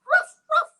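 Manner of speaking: high, bright woofs; playful woofing; dog woofs. Pretend. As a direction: bark like a puppy. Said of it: "or he can say".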